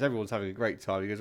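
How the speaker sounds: normal talking voice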